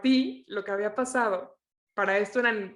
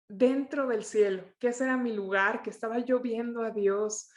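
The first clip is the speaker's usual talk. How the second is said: ordinary delivery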